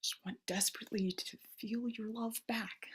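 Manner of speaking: breathy, pleading whisper; desperate whisper. Acted. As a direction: whisper it like a secret confession to God. Desperate prayer to God.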